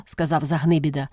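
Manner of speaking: plain reading voice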